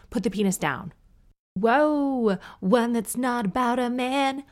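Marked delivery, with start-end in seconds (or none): 1.6-2.4 high, loud whoa of relief; bright whoa; excited whoa. Real, not acted. Relief at a new email. 2.6-4.4 high, loud, delighted; gleeful announcement. Real, not acted. Delight at different email.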